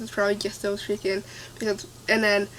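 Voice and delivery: ordinary talk, nothing marked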